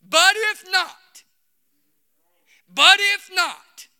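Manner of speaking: loud, high, drawn-out cry; slow, ringing pulpit shout; climactic preacher's shout. Real, not acted. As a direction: belt it out slowly across the congregation. Climax of the sermon's quote.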